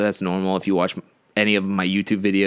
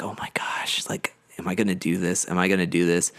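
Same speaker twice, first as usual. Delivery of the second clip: nervous whisper; 4 semitones lower, 5 dB quieter than usual. Retold or imitated. Anxious inner thoughts before speech.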